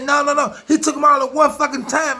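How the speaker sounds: high, loud retelling of her outrage; retold excited exclaim. Retelling his friend's date story, high and loud exclamation. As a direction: throw the words up high and hard like she can't believe it. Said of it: "she said".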